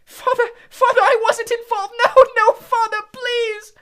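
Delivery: very high, very loud pleas; frantic high screaming pleas; desperate begging. Acted. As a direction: beg father while dragged away. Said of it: "boy dragged away begging".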